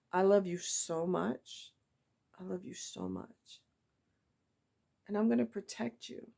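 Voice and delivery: real emotion creeping into a loving promise; emotional. Real, not acted. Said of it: "why am I getting emotional".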